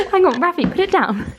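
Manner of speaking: half-laughing, tell the little one to put the toy down. Real, not acted. Telling toddler to drop toy.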